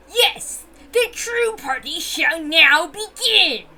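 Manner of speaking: slow, drawn-out proud proclamation; grand declaration. Acted. Witch declaring the true party has begun, slow and grand. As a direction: savour each word like a triumphant witch.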